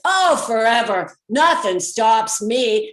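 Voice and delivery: loud, slow boast over the wind; talking loudly. Retold or imitated. Tow driver shouting over the open windows, loud. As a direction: project over rushing air from open windows. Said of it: "he's talking really loudly to be heard over the air".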